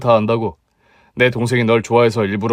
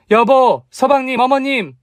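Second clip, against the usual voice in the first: calling out; 13 semitones higher, 3 dB louder than usual. Acted. Calling family in from outside.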